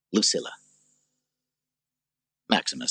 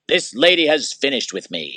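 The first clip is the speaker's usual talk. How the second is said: louder, higher call to the guard; 8 semitones higher, 6 dB louder than usual. Acted. Maximus calling the guard to take Lucilla away.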